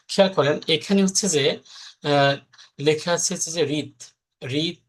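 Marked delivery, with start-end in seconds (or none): none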